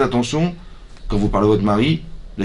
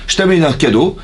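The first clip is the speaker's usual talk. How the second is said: indignant cry; 5 semitones higher, 5 dB louder than usual. Acted. Cain voiced in argument.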